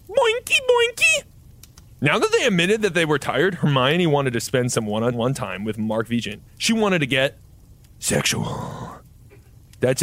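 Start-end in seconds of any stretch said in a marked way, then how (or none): 0.1-1.2 squeaky high silly chant; sing-song it like a cartoon spring; boinky sound. Reader opens chapter six with silly squeaky high chant. 8.0-9.0 low breathy whispered word; sultry whisper. Acted. Reading the ascii word as a low sultry whisper.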